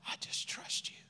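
very soft, breath-only surrender; whispered prayer. Acted. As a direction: whisper it trustingly to God. Models a prayer of trust.

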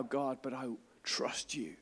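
soft unvoiced whisper of trust; whispered prayer. Preacher quoting a whispered prayer, hushed. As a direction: breathe the prayer out quietly.

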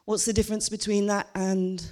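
usual speaking voice

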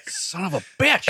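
slow, grumbled mock curse; mock wife cursing the compliment; annoyed wife. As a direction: mutter it like a fed-up wife. Wife's grumbled reply.

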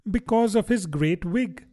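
put-on a girl savouring what she knows; lightly voiced young Alice; Alice to herself.

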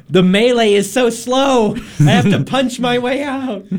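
high, drawn-out mock whine; exasperated complaint. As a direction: complain loudly, stretching the words in exasperation. Acts out painful playthrough complaint.

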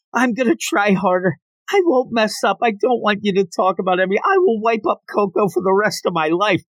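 high, earnest-sounding mock promises; over-the-top pleading. Anya throws herself at Twilight, pleading over the top. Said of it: "She throws herself at Twilight".

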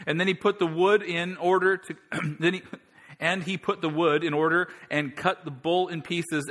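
high, loud storytelling sermon; preacher retelling the contest, loud and high; preaching at full force. Acted. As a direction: drive the scene home to the crowd.

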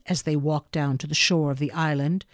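plain reading voice

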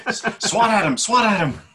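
high-pitched, urgent call; excited cry. Pretend. Player urging an attack on the troll, excited.

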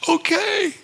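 put-on small boy's okay. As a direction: agree like a scared little boy. Boy finally agreeing.

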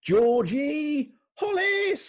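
high, drawn-out shout; announcer's cheer. Pretend. Host revealing next week's guest, excited announcer shout. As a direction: build the name into a big reveal.

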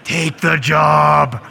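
slow, loud, firm order; God cutting off the whining martyr with a shout; stern shouted command. Acted. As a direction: bark it out slowly like a fed-up God.

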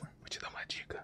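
whispered aside off mic; whispering. Real, not acted. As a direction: whisper the tip so nobody hears. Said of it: "eu falei pra ninguém ouvir".

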